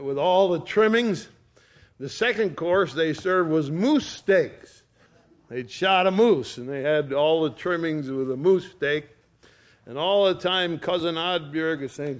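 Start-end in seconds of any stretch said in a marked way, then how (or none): none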